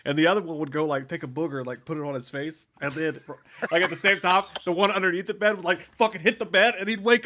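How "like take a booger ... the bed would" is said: ordinary talk, nothing marked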